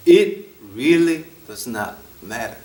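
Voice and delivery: each word drawn out slowly; slow emphasis. Real, not acted. Preacher stressing possessions don't matter, very slow. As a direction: lay down every word.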